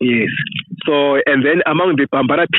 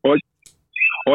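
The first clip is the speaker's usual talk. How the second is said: ordinary talk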